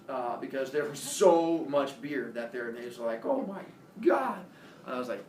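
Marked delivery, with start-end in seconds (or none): 3.2-4.4 high, drawn "oh my God"; astonished cops. Retold or imitated. Cops staring at so much beer, astonished. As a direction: let the amazement push the voice up.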